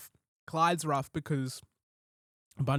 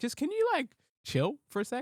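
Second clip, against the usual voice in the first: very high strained voice; high exasperated plea; 11 semitones higher than usual. Retold or imitated. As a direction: squeak out a plea to calm down. Exasperated reply to girls.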